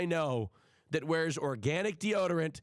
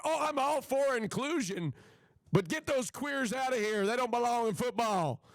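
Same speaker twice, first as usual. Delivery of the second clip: high-pitched mocking paraphrase; mocking the commentator; 6 semitones higher than usual. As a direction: parrot his view back in a high, scornful voice. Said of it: "That's what he's saying".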